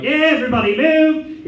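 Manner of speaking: high, loud mimic of the shout; retold usher shout. Priest recalling the usher, shouting high and loud. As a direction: echo the usher yelling. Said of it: "going through like".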